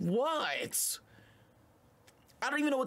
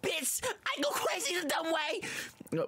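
higher, slow mock of her aggressive bar; mock rapper; 10 semitones higher, slower than usual. Host mocks aggressive female rapper, lightly voiced. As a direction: parody her rapping, drawn out. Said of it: "why is she so aggressive".

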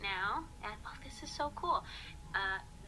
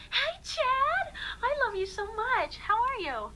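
high, loud gushing hello; excited greeting; 14 semitones higher, 8 dB louder, slower than usual. Real, not acted. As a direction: squeal hello to a friend. Greeting a friend in comments.

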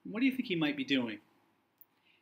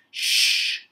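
loud unvoiced hushing shh; librarian shush; 6 dB louder than usual. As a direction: shush the noisy pirate firmly. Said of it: "with a pinch of a frown".

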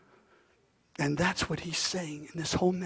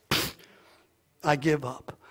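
usual speaking voice

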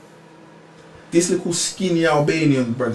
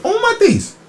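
shocked exclamation. Retold or imitated. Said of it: "I'm saying".

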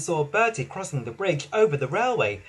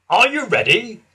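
station master; 6 semitones higher, 8 dB louder than usual. A narrator's reading. Station master starting race.

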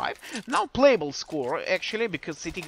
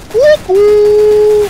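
shrieked cuckoo, very high and loud; loud high cuckoo call; 13 semitones higher, 17 dB louder than usual. Real, not acted. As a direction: scream cuckoo at the top of the voice. Caster's catchphrase on a kill.

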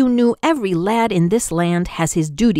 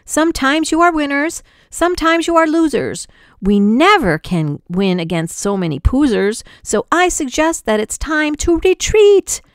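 much higher, loud and fast excuse; general shouting; 9 semitones higher, 3 dB louder than usual. A narrator's reading. General telling his men to retreat, high loud shouting. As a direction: bluster through the retreat order. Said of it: "shouted out to his men".

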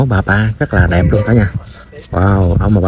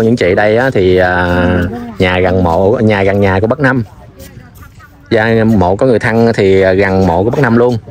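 usual speaking voice

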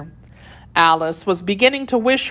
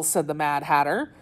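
ordinary delivery

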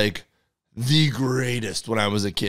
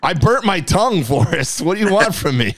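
high-pitched comic protest; mock exasperation; 6 semitones higher than usual. Cohost excuses his bad reading to Forrest, mock exasperated. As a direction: whine back in playful self-defence.

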